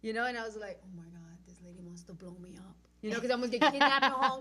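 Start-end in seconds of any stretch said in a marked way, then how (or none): 0.8-2.8 hushed, retold alarm in a whisper; whispered inner thought. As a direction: whisper it like a secret fear.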